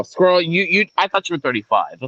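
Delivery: normal talking voice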